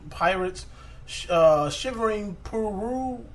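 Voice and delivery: usual speaking voice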